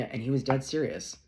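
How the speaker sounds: ordinary talk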